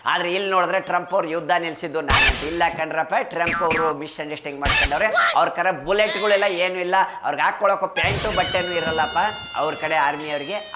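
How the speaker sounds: ordinary talk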